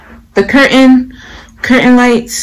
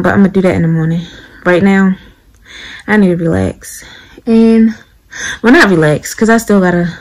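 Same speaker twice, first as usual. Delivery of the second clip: ordinary talk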